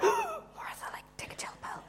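hushed peacekeeper's telling-off; very soft, horrified hiss of reproof; scandalised whisper. Acted. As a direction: whisper it so nobody else hears. Voicing timid peacekeepers, after a gasp.

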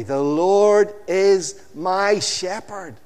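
"Lord" drawn out high and loud, slow; "Lord" stretched and loud, said for all to repeat; stress on Lord. Shown as a demonstration. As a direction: lead the congregation, slow, "Lord" rolled. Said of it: "Say it with me".

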